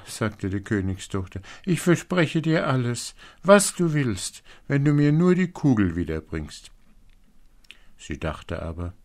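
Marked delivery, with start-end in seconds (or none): 1.6-6.7 high, loud, pleading princess voice; eager princess. A narrator's reading. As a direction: promise anything, pitched well up. Princess promises everything. (flehende Prinzessin, hoch und laut)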